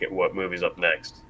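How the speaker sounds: ordinary talk, nothing marked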